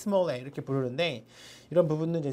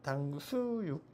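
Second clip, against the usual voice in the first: 당수육 slow, soft and lower; stress moved, slow and soft; 3 semitones lower, 10 dB quieter, much slower than usual. Shown as a demonstration. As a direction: say 당수육 slowly with a shifted stress. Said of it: "아무 때나 막 강세를 넣을 수가 있어요". (당수육 천천히 작게)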